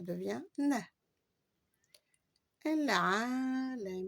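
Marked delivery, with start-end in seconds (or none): 2.6-4.1 El Alamin said slowly, the final n sounded; slow demo. Shown as a demonstration. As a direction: draw out El Alamin. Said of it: "on devrait dire El Alamin". (El Alamin lentement)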